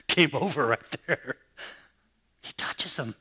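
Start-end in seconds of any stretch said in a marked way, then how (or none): none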